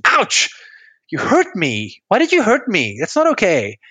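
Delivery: high pained cry of ouch, then accusing; yelp, then accuse your partner; hurt yell. Retold or imitated. Dance partner with sore toe yelling at partner, high hurt yelp. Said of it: "suddenly yells at you and says".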